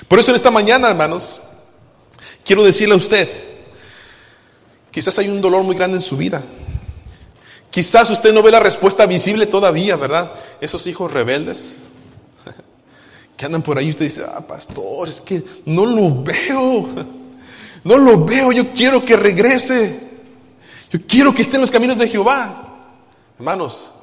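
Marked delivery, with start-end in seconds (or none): 0.1-10.0 raised, driving preacher voice; preaching at full force. Acted. Pastor preaching high and loud to the congregation. 14.3-22.8 mock pleading parent.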